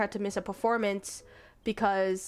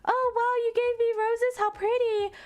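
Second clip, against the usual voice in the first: much higher, sugary gushing voice; gushing fiancee; 12 semitones higher than usual. Retold or imitated. Fiancee thanking him for the roses, high sugary gush. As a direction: coo over the roses in a sweet high tone. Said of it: "she's like".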